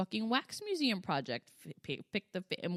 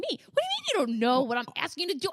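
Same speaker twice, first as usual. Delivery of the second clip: raised, high-pitched, rushed exasperation; retold annoyed outburst; 6 semitones higher, 9 dB louder, faster than usual. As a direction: fire back in mock exasperation. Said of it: "now he's getting annoyed at me".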